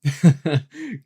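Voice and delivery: short, loud, high laugh; quick burst of scornful-sounding laughter; acted laugh. A narrator's reading. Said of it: "Kyle laughed".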